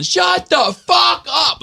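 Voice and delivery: high, drawn-out shout; stretched-out, high-pitched road-rage scream; retold yelling. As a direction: holler each word slowly across a highway. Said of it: "I just started yelling".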